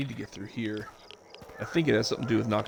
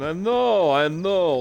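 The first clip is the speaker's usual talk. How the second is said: annoyed; 5 semitones higher, 8 dB louder, much slower than usual. Real, not acted. Annoyed by radiation warning.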